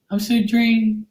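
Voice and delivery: very high mocking voice; mock whiny readers. Mimicking readers who claim exhaustion, mock whining. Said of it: "How many of these readers that do".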